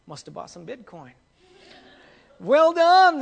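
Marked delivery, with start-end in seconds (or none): 2.4-3.2 high, loud praise bursting out; exclaimed. A narrator's reading. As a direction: cry out in delight as the king. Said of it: "the king exclaimed".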